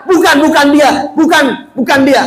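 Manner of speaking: high, insistent character voice; protesting blind man. Acted. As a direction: protest high, rejecting the stranger.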